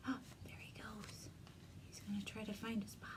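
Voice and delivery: hushed unvoiced whisper; quick secret whisper. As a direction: whisper so nobody hears. Whispered aside over book.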